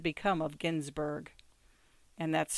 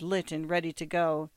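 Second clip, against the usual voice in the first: ordinary delivery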